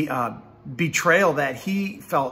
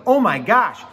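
excited realization; 4 semitones higher, 4 dB louder than usual. Acted. Peter recognizes Jesus from the boat.

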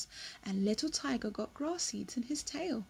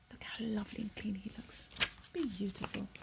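softer, quick whisper; whispered admiration; 2 semitones lower, 9 dB quieter, faster than usual. Real, not acted. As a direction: marvel under your breath. Soft admiring aside.

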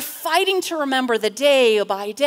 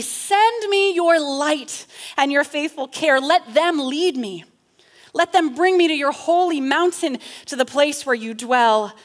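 loud, raised plea; pleading petition; 4 semitones higher, 5 dB louder than usual. Acted. Psalmist petitioning God for light, forceful plea. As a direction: pray it out forcefully.

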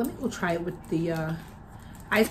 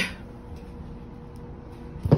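a brief real cough; one more cough from the spray; short cough. Real, not acted. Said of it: "And I'm coughing from it".

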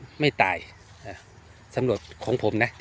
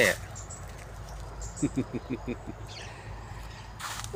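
soft breathy chuckle; 8 dB quieter than usual. Real, not acted. Gardener amused while showing his plants, soft chuckle.

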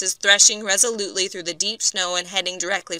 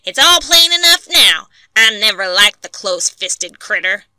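scornful camp man; 2 semitones higher, 8 dB louder than usual. A narrator's reading. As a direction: spit out the disdain. Angry camp men.

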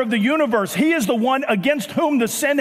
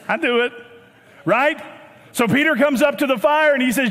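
ordinary talk

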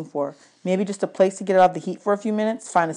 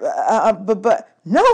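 stammering; 4 dB louder than usual. A narrator's reading. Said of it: "I stammered".

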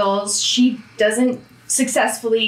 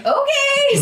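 high-pitched yielding okay; mock-startled, much higher voice; taken-aback okay; 14 semitones higher than usual. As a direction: back off with a squeaky okay. Retold reply after being sworn at.

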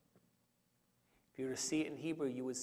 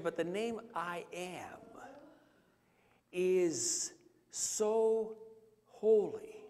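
emphatic; 4 semitones higher, much slower than usual. Real, not acted. Preacher stressing God's holiness.

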